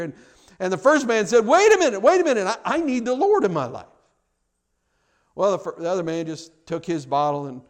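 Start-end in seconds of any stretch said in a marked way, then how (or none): 1.4-3.9 urgent plea. Acted. Drunk man suddenly urgently stops preacher.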